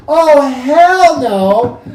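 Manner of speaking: high, loud, drawn-out refusal; retold disbelief. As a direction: draw it out loud in alarm. Retold alarm at empty closet.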